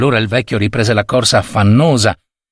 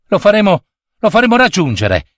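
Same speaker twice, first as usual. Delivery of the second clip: put-on high, urging child's voice; insistent boy; 7 semitones higher than usual. A narrator's reading. Boy urges the servant to chase the thief, insistent. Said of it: "insisté Basile".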